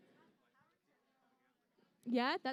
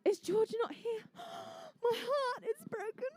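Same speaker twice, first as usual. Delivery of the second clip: gasp, then a high, slow, hammed-up sob; mock heartbreak; 11 semitones higher, slower than usual. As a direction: play the betrayed friend, over the top. Learns best buddy George is absent.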